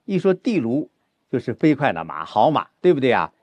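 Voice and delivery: ordinary delivery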